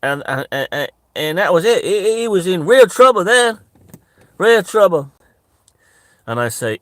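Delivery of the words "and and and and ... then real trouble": high, loud, stammering into an emphatic cry; excited storyteller impression. Catfish telling of his arrest, loud high-pitched alarm. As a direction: hammer out the trouble, loud and high, then repeat it. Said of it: "And he goes".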